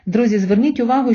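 usual speaking voice

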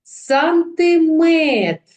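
"Сантиметр" loud, high and very slow, weight on "метр"; called-out "Сантиметр" with a long stressed "метр"; stress on last syllable. Shown as a demonstration. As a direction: stretch and punch the final syllable of "Сантиметр". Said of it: "Наголошений останній склад".

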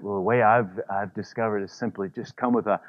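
ordinary talk

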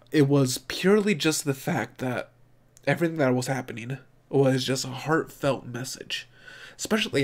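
usual speaking voice

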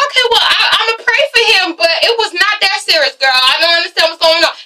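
high, breezy imitation; mock Carmen. Vlogger imitating Carmen greeting the cold ladies, high and breezy. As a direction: chirp it up high, playing innocent. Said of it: "And then Carmen's".

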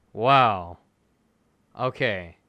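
ordinary talk

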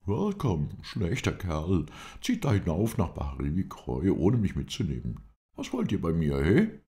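mock-grumpy welcome in a high character voice; half pleased, half sulking. A narrator's reading. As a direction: greet him with a pleased scolding, rising to a loud "he?". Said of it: "halb erfreut und schmollend".

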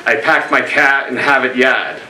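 upper-class Boston accent, "packed" with a flat a, "Harvard Yard" as Hahvad Yahd; lightly done upper-class Boston accent. Shown as a demonstration. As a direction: do the Boston Brahmin accent, no r's anywhere. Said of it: "an upper-class Boston accent where you would say".

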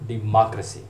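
डेमोक्रेसी as di-MAHK-ruh-see; American pronunciation. Shown as a demonstration. Teacher contrasting mo with ma, American di-MAHK-ruh-see for डेमोक्रेसी. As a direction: open "ah" in the stressed second syllable of डेमोक्रेसी. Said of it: "जो American pronunciation है".

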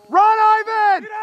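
very high cheering cry; urgent yell. Real, not acted. Commentator urging Ivan to run, urgent yell. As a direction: holler to spur the runner on. Urging runner during play.

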